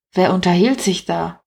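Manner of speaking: usual speaking voice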